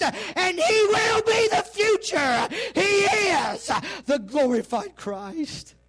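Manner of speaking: high, slow, crying shout; preaching shout. Acted. Preacher to church at the peak, a high crying shout. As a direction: cry it out at the peak. Climax of the sermon.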